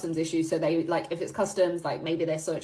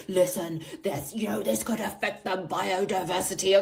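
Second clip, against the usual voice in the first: lightly imitated officer laying down the law; mock-grave customs man going hard; a hint of a stern official delivering a warning. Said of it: "That man was going hard".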